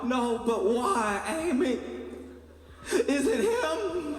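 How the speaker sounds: very high, slow, tearful whine; high drawn-out mock crying; mock sobbing plea. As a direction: beg his ex through hammed-up tears. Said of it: "He's like".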